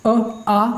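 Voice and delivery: अ said short, then आ clearly drawn out; short vs long vowel demo. Shown as a demonstration. Teacher contrasting vowel lengths for students, अ short then आ long. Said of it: "sir अ में कम लगेगा".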